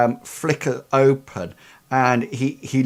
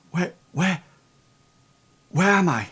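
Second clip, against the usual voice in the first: higher, hesitant and nervous; mildly acted nervous confusion; 5 semitones higher than usual. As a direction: falter as a lost boy coming round. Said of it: "he seems, um, incredibly, um, um, nervous".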